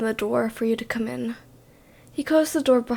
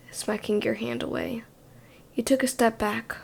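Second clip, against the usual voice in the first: ordinary delivery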